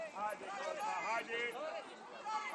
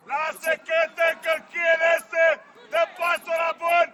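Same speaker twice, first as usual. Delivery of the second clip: loud, raised scolding from the coach; shouted reprimand; 4 semitones higher, 11 dB louder than usual. Real, not acted. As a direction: yell at the players across the pitch. Coach scolding players during match.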